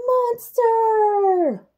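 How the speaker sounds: high, loud cry of surprise; surprise shout. Acted. Narrator springing the monster on children, loud and high. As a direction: shout it like a jump scare.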